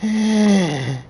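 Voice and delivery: long held mock-witch drone; imitated droning chant. Reviewer imitating witches linked by a well, droning. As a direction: hold one wordless note, as if chanting.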